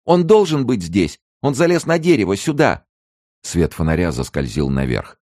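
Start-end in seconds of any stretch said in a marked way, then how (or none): 0.1-2.9 very high, loud hysterical cry; harsh hysterical voice. A narrator's reading. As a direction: give him away in a hard, hysterical shriek. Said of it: "жёсткий истеричный голос женщины".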